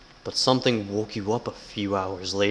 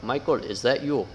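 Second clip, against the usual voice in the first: ordinary talk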